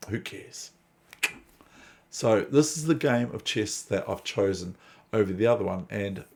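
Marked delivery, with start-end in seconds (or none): 0.1-0.7 whispered aside. Real, not acted. Dismissive repeat.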